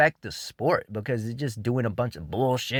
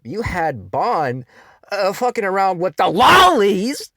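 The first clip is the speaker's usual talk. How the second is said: disgusted mockery; 9 semitones higher, 8 dB louder than usual. Real, not acted. Mocking rant about a character.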